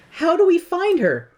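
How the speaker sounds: high anxious question; worried Dorothy. Retold or imitated. Dorothy asking the guard how to find the witch, high. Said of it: "Dorothy asks".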